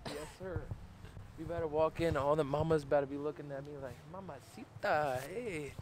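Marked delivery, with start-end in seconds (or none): none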